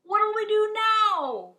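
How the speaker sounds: high, loud, slow panicked pirate; pirate crying out in the storm; pirate yelling. Acted. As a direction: shout it over the wind. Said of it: "yelled one of the pirates".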